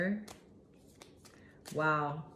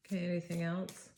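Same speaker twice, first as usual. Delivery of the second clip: normal talking voice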